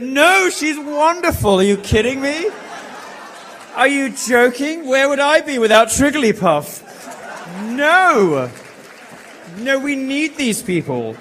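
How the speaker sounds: high, loud, incredulous defence; mock-adoring exclamation. Real, not acted. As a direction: gush loudly over the crowd in mock devotion. Loudly defends heckler to cheering crowd.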